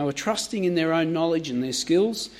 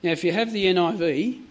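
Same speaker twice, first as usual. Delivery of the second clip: ordinary delivery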